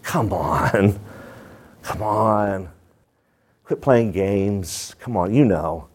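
low, drawn-out mock pleading; lightly voiced weary friend; exasperated. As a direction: coax someone who's playing dumb. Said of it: "and you're like".

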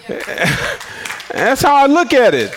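high, slow, laughing it out. Real, not acted. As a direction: chuckle through the words. Laughs at his own point.

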